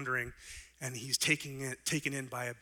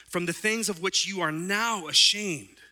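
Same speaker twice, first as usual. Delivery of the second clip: fired-up preaching; 6 semitones higher, 7 dB louder than usual. Acted. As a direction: preach Paul's words with fire. Preaching Paul's verses.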